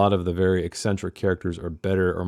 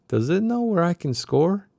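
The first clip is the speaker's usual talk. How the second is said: pitched-up stoner voice; higher character voice; 10 semitones higher than usual. Acted. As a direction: lift it into a dazed character voice. Doc voiced in read novel.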